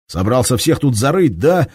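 loud, high sneering snarl; bare your teeth at him. Acted. Shams snarls at the commander, loud sneer. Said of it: "ощерился Шамс на Лихолетова".

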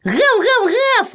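very high imitated yapping; dog barking. A narrator's reading. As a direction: bark like a small excited dog. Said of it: "лаяла беззубая и безглазая собака". (высокий собачий лай)

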